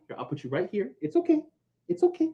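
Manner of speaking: high, soft coaxing of the dog; soothing puppy. Real, not acted.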